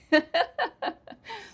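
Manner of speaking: loud, high giggle; real bubbling high laughter; amused laugh. Real, not acted. Teacher laughing at "the real question is why", high laugh. Laughs at her joking reproach.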